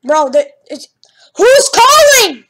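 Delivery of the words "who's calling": high, loud, drawn-out demand; exasperated yell. Real, not acted. Gamer interrupted by a phone call, exasperated yell. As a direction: yell it slowly, really put out.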